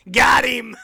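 high, shouted gotcha; gleeful crowing. Pretend. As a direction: crow it like a punchline landed. Gleeful punchline call-out after laughing.

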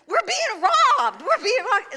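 high, loud and slow, laughing; panicked warning. Retold or imitated. Woman telling the driver through his window, high panicked call. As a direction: plead the news high and loud.

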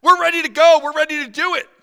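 high, loud, taunting eager voice; sing-song mock of the eager enemies; mocking eager crowd. Preacher mocking the enemies' eager readiness, high and loud.

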